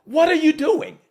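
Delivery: high, loud repeated demand; exasperated director. Retold or imitated. Director at the actor, loud and exasperated. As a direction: hound him to say his line. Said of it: "he looks at me".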